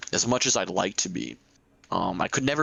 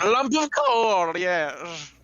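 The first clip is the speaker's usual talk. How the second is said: much higher, slow and loud; high put-on voice; 9 semitones higher, 4 dB louder, much slower than usual. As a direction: squeak it out slowly. Repeats in put-on voice.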